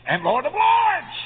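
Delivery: high, loud, slow proclamation; triumphant preaching shout. Real, not acted. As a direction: thunder out the title with LORDS held high. Title repeated as a triumphant proclamation.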